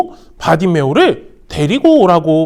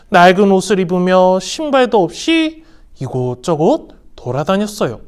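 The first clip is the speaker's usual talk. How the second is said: plain reading voice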